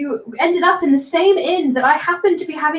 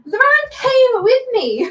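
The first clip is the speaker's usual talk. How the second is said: high, slow, emphatic retort; heated retort; 9 semitones higher, slower than usual. Acted. Bather standing to tower over the other, heated retort. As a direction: draw it out, pitched well up, to overrule them.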